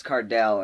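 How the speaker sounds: "Cardell" ending like "dell", stressed; correcting pronunciation. Retold or imitated. Student correcting the substitute, stressing "Cardell" with short e. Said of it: "that was his name".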